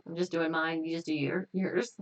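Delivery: ordinary talk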